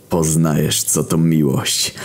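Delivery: usual speaking voice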